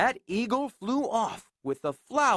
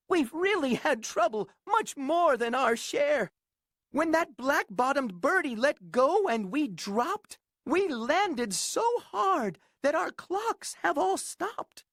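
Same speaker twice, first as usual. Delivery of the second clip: high small pleading voice; pleading mayor; 7 semitones higher than usual. Acted. Mayor begging Horton to stay, high pleading. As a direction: plead in a tiny voice. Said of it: "pleaded that voice".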